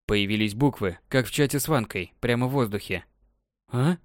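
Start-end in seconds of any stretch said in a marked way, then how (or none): 3.7-4.0 surprised. A narrator's reading. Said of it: "спросил вслух удивлённо".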